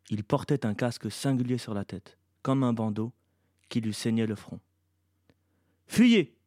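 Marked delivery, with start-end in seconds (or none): 5.9-6.4 high, loud cry of alarm; shouted warning. A narrator's reading. Shea warns the others as the creature appears, shouting. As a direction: shout it as an order to run. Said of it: "cria Shea".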